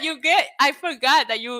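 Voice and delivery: usual speaking voice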